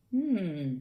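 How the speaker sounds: ordinary delivery, nothing marked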